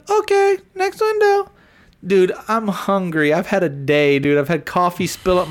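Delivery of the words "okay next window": very high, louder, bright customer-service voice; squeaky drive-thru greeting; drive-thru worker. Retold or imitated. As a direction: chirp it like a cheerful fast-food cashier. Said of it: "She said".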